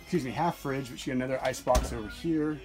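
ordinary talk, nothing marked

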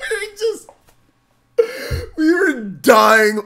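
words through big laughter; cracking up, can barely talk; laughing hard. Real, not acted. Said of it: "I've never laughed so hard in my life".

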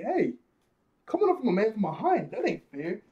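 high-pitched hero complaint; mock indignant protest. Luffy scolding an attacker from behind, mock indignant.

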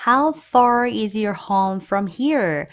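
drawn-out slow question for the class; very slow model reading. Shown as a demonstration. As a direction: slowly lay out every word. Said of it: "그래서". (천천히 또박또박 읽어주기)

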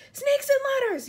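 very high, loud exclamation; alarmed yell. A narrator's reading. Fire chief seeing the giant spider, yelling. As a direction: yelp it out in horror. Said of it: "yelled the head of the fire department".

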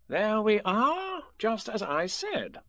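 slow, high, self-satisfied Rabbit; proud. A narrator's reading. Rabbit congratulates himself on losing Tigger, proudly. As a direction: draw it out high with pride. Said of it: "he whispered proudly".

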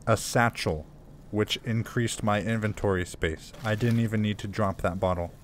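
ordinary talk

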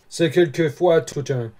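ordinary delivery

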